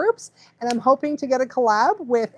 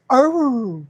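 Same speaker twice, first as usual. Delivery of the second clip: imitated husky "arooo"; drawn-out howl sliding down in pitch; speaking husky. Shown as a demonstration. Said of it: "if a husky was".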